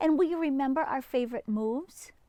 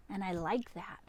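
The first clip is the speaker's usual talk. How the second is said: soft, lower whisper; 4 semitones lower, 6 dB quieter than usual. Acted. Child telling dad about hugs, whispered. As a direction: confide it in a tiny whisper.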